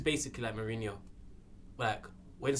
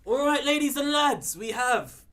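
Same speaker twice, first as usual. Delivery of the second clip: much higher and louder, slow, stretched out; hype announcer; 12 semitones higher, 10 dB louder, slower than usual. Acted. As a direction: sell it like a giveaway host. Announcing a ticket giveaway.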